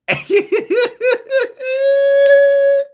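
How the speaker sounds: high howling laugh that breaks into a shout; shrieking laugh; loud laughing shriek. Real, not acted. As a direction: crack up loudly, near screaming. Laugh after teasing her revenge.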